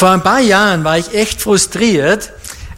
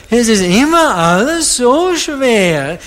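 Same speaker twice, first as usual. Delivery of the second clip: mock whiny, higher and drawn out; weinerlich imitiert; 4 semitones higher, slower than usual. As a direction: play the sad complainer, half-mocking. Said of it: "die reden immer so weinend". (leicht weinerlich nachgemacht)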